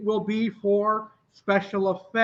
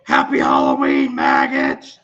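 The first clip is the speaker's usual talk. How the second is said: much lower, loud barked sergeant shout; drill sergeant; 5 dB louder than usual. Acted. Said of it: "in my sergeant voice".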